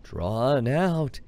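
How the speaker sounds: slow, stretched-out words; drawn out. Shown as a demonstration. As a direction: drag the words out slowly. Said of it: "talks really slow and drawn out".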